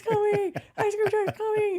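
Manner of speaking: high, loud imitation of a frantic child; mock-frantic shout to the whole house; excited kid calling out. Kids hear the truck, scrambling.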